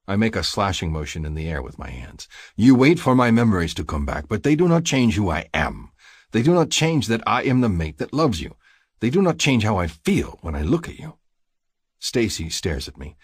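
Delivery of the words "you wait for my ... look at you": louder, higher heated declaration; frustrated, forceful avowal; impassioned protest. A narrator's reading. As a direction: insist on your love with raised voice.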